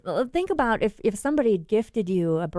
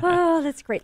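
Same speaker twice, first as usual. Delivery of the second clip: laughing; 8 semitones higher, 4 dB louder than usual. Real, not acted. Laughs at accent joke.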